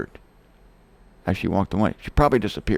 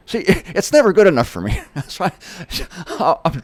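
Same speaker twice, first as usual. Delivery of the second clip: laughing at himself, louder; 6 semitones higher, 4 dB louder than usual. Real, not acted. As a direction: laugh through the words.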